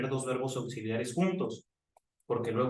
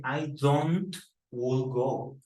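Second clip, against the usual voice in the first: slowly spelled-out error; drawn-out incorrect form; slow wrong example; much slower than usual. Shown as a demonstration. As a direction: say the mistake slowly. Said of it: "No dice".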